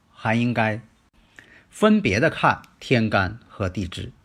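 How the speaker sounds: ordinary delivery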